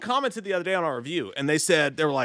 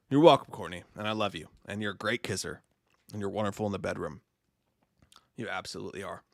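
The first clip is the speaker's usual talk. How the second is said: mock tender; 10 semitones lower, 5 dB quieter than usual. Fake seductive address to co-host.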